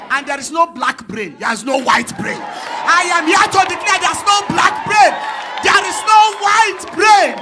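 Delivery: loud, high proclaiming, slowing at the end; full-force preaching. Real, not acted. Preacher declaring to the congregation, at full force.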